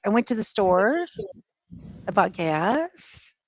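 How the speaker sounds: "store" and "gas" each swooping up like questions; question-mark rise at the end of "store" and "gas"; uptalk. Shown as a demonstration. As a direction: turn the statement into questions, rising on "store" and "gas". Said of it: "question marks come at the end".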